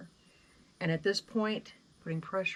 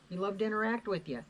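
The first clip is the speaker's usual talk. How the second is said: ordinary talk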